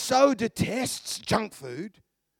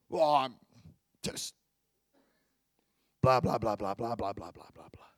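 blah trailing off lower and softer into a whisper; fading mock self-talk; 10 semitones lower, 8 dB quieter than usual. Shown as a demonstration. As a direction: mumble the nagging until it dies away. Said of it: "You're mad with yourself".

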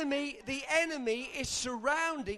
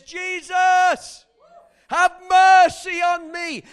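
loud, high cry for mercy; desperate shout; 8 semitones higher, 16 dB louder, much slower than usual. Retold or imitated. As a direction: yell it at the top of your lungs for help. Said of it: "he was shouting so loud".

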